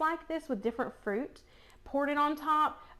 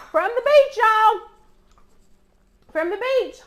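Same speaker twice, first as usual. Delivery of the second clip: cheerful sign-off; 8 semitones higher, 10 dB louder than usual. Real, not acted. Vlog sign-off.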